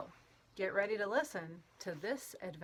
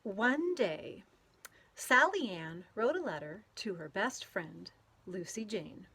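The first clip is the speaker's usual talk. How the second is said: lively storybook read-aloud with playful sound words; reading to children; 3 dB louder than usual. A narrator's reading. Teacher reading a picture book to children, lively and playful. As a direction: read it out to a group of kids, bouncing along.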